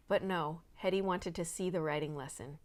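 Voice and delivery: ordinary delivery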